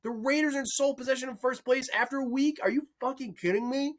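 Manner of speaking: high, outraged fan's disbelief; angry Denver fan. Retold or imitated. Imagined Denver fan ranting at his team, high outraged disbelief. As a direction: vent as a betrayed fan would. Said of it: "I'm like".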